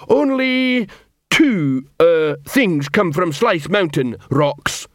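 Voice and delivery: slow, halting troll tirade; troll drill sergeant. Acted. Troll sergeant struggling through his insult to a recruit, hectoring. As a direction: start high and emphatic, then grope for words.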